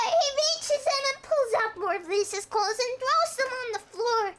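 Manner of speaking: ordinary talk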